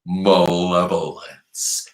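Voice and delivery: malevolence said slowly, every syllable clear; modelled word. Shown as a demonstration. Teacher modelling a new word, slow and clear. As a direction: stretch out malevolence for kids to repeat. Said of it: "Say that word".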